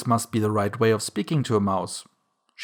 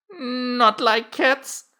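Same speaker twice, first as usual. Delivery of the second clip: very high, drawn-out indignant mouse; shrill, passionate; 13 semitones higher, 3 dB louder, much slower than usual. A narrator's reading. The mouse cries out at Alice about cats, shrill and passionate. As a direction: squeal it slowly in outrage. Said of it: "in a shrill, passionate voice".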